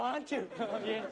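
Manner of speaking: ordinary talk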